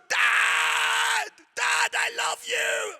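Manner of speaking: very high, loud, slow cry to dad; tearful shout. Retold or imitated. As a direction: shout it through happy tears. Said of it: "I'm starting to bawl my eyes out".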